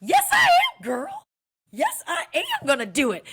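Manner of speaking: high, loud excited shout with a laugh; excited yell. Retold or imitated. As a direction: shout it out in joyful surprise. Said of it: "so earnest and so honest and so excited that I said".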